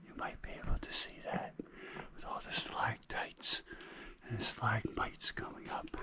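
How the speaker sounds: hushed whisper in a dark cave; cave echo. Real, not acted. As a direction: whisper so as not to disturb.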